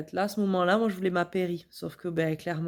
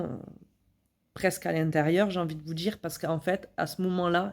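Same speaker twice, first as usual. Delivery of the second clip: ordinary talk, nothing marked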